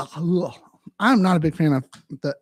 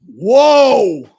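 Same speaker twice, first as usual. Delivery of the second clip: loud, higher, long whoa; mock amazement; 4 semitones higher, 11 dB louder than usual. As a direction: ham up being amazed.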